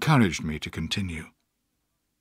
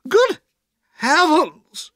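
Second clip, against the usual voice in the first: very high, louder startled exclamation; shocked cry; 6 dB louder, much slower than usual. A narrator's reading. Aunt learns the boy is her nephew, shocked. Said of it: "she cried".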